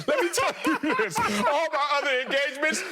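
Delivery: high, squealed through laughter; laughing protest. Real, not acted. Laughing protest over teased past engagements.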